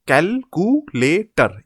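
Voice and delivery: calculator slowly split as cal-cu-la-tor; four separate beats in calculator; syllable by syllable. Shown as a demonstration. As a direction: break calculator into slow, distinct syllables. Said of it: "break it into four syllables".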